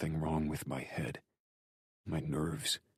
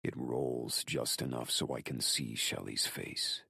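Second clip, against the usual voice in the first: usual speaking voice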